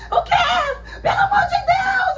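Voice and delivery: a frightened woman shrieking into the phone; high-pitched panicked wail; panicked cry. Retold or imitated.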